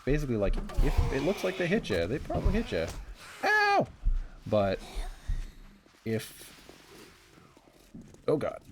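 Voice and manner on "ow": sharp high loud yelp; pained yelp. Real, not acted. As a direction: cry out from a hit. Hit by zombie.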